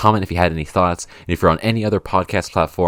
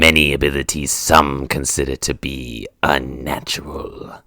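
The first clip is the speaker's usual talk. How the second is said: Darth Sidious impression; 3 semitones lower than usual. Comedian impersonating the Star Wars villain, low creaky whisper. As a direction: hiss out the dark side like an old emperor. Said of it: "He goes like".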